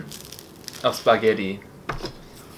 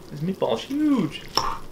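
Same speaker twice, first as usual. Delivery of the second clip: surprised; 12 semitones higher, 4 dB quieter than usual. Real, not acted. Reacting to a big meatball.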